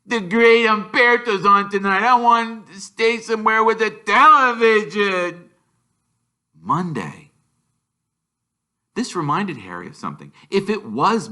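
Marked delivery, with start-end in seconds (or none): 0.0-5.4 put-on high, loud spoiled-boy whine; whiny Dudley. A narrator's reading. Dudley to his mother, high and whining.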